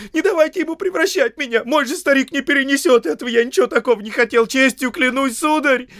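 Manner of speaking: very high, loud, fast babble; terrified servant squealing for mercy; panicked squealing. Acted. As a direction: shriek for protection in a rush. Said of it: "заверещал Сэм".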